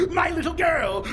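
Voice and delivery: proud cackle and shout. Acted. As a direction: crow with pride over her. Eggman proud of his creation.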